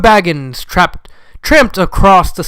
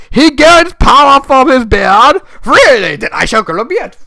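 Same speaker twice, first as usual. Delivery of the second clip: mock wizard voice; 10 semitones higher, 6 dB louder than usual. Mocking wizard's beard power.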